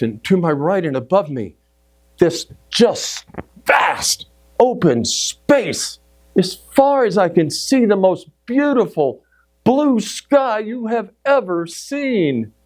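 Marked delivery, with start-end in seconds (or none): 2.2-12.5 high, drawn-out wonder; awestruck. Retold or imitated. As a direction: marvel slowly at a vast view. Reliving awe at the open sky.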